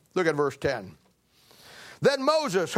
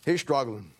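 normal talking voice

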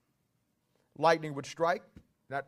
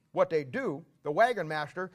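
ordinary talk

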